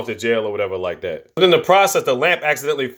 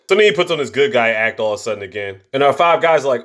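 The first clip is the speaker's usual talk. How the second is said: ordinary talk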